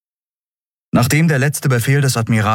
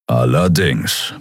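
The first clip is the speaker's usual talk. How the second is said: low, drawn-out grumble; 6 semitones lower, slower than usual. A narrator's reading. Keru answers Endriel as she hands him the crystal, grumbling. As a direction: grumble it slowly, pitched down. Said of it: "brummte er".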